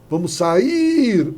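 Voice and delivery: SAIR with a very long, strong I; stressed long I. Shown as a demonstration. Teacher proving the I is tonic, SAIR hugely stretched on I. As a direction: hold the I of SAIR way out. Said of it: "Ele é tônico? É forte".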